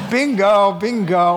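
real laughter through the words; laughing. Real, not acted. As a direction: burst out laughing while agreeing. Laughing at the accusation.

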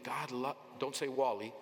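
usual speaking voice

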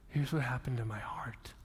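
hushed whisper on "heart". Shown as a demonstration. Preacher giving the psalm's true answer in a whisper. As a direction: whisper it like a secret. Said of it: "He doesn't say".

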